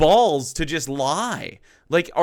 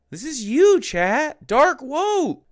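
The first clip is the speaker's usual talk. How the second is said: mock hype; 8 semitones higher, 4 dB louder, slower than usual. Hyping chat with slogan.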